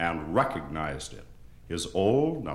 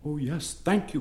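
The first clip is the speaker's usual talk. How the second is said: furniture chorus; 8 semitones higher than usual. A narrator's reading. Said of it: "cried the furniture".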